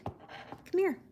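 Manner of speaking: whispered call. Real, not acted. Coaxing the cat quietly.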